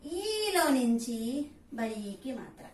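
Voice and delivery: ఈలో stretched very slowly; very slow demo. Shown as a demonstration. Teacher drawing out ఈలో very slowly for pupils. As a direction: spell out ఈలో నుంచి slowly.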